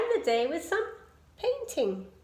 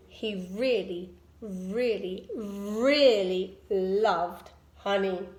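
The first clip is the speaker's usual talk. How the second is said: drawn-out lower really really really; slow emphatic really; 5 semitones lower, slower than usual. A narrator's reading. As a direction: lean slowly on each really. Emphatic narration.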